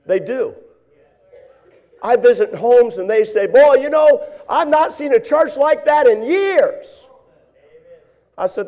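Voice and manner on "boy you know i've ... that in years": high-pitched mock enthusiasm; imitating impressed visitors. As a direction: gush like an amazed church visitor. Visitors' enthusiastic praise, retold.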